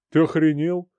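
loud, indignant outburst; outraged. Acted. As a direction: snap back in outrage. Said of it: "искренне возмутился Борис".

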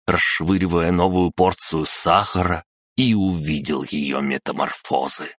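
ordinary delivery

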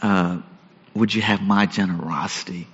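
soft, low, searching question; quiet, tender appeal from God to Jonah. Retold or imitated. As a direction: ask gently, almost under the breath. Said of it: "God says".

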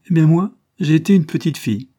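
loud, high, laughing burst; laughing exclamation. A narrator's reading. Fernand to Geneviève, loud laughing exclaim. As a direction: cry it out with a laugh. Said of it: "s'écria Fernand en riant".

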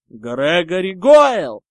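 very loud, slow yell. A narrator's reading. Harry challenging Goyle in the air, yelling. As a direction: bellow the name out long. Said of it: "завопил Гарри".

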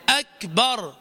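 "أكبر" as "ak-bar" with light air on K; correct still K. Shown as a demonstration. Teacher having students repeat after him, still aspirated K. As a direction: model أكبر clearly, no vowel after K. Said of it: "ردت خلفي".

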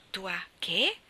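mild startled exclaim; a stunned little outburst; astonished exclaim. A narrator's reading. Joe hearing Laurie say my wife, startled. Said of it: "esclamò Joe".

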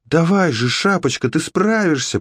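high-pitched cheering on; encouraging. A narrator's reading. Alex cheers Red on after her fall, encouraging. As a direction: urge a friend on in a high voice. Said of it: "подбадривала её Алекс".

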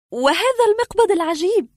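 high-pitched challenging question; puzzled woman patient. Acted. Patient asks doctor about the strange knob, high and challenging.

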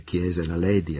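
plain reading voice

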